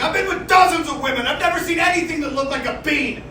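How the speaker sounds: lightly voiced smug guy; bragging dude. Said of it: "This dude's like".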